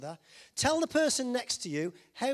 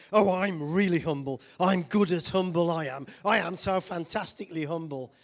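mock humble boasting; 3 semitones lower, 7 dB louder than usual.

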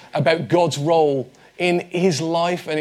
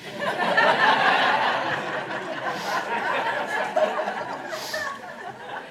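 laughs; 5 dB quieter than usual. Real, not acted. Laughs at own joke.

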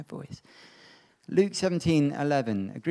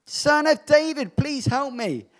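high, loud, slow desperate cry; shouting plea; 11 semitones higher, 7 dB louder, slower than usual. A narrator's reading. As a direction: cry out for help louder. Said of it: "he shouted more and more".

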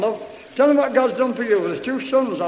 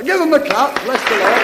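calling out; 3 semitones higher, 7 dB louder than usual. Real, not acted. Leader calls for applause.

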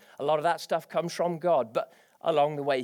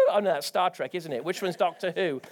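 ordinary talk, nothing marked

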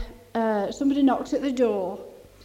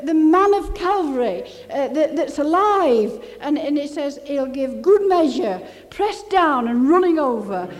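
fervent preaching; 4 semitones higher than usual. Acted. Preacher to his congregation about feeling God, fervently preaching. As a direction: preach it out with mounting fire.

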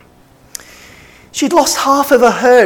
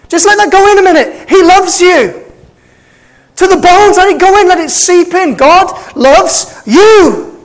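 impassioned preaching; 5 semitones higher than usual. Real, not acted. Pastor urging the congregation to feel loved, fervent preaching. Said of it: "Am I okay to preach like this?".

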